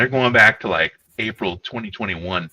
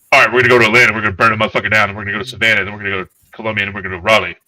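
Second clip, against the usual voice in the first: loud, brash general barking orders; booming Sherman voice; 7 dB louder, much faster than usual. Retold or imitated. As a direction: bellow the march plan like a swaggering general. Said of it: "a deep voice".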